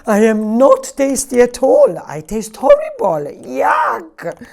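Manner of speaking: high, loud yuck; disgusted melon. Acted. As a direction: spit out the taste. Melon disgusted by own taste.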